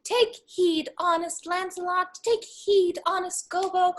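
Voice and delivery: high-pitched little voice of conscience; put-on high voice giving a warning; conscience's voice. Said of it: "My conscience says".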